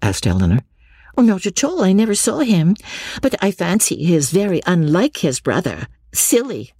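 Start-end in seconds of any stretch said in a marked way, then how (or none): 1.2-6.7 high-pitched Lucy, airily dismissive. Acted. Lucy answers Eleanor in character.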